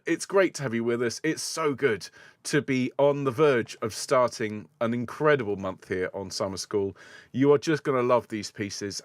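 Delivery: ordinary talk, nothing marked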